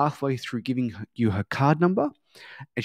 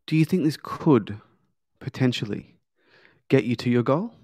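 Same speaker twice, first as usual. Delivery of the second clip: COULD leaned on, short pauses, GOAL rising; curious questioning. Shown as a demonstration. Coach repeats the question to client, curious. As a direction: pause before "get", lift "goal" upward. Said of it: "I'm upwardsly inflecting the word goal".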